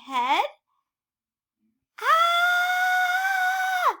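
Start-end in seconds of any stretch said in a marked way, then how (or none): 2.0-4.0 high, loud cry of alarm; startled shriek. Acted. Elephant shrieking as a bird lands on his head, high and loud.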